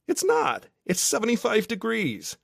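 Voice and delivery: very high-pitched objection; high, disbelieving rebuttal; incredulous protest. A narrator's reading. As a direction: contradict your mate in a high voice. Cole disputes that it's freezing.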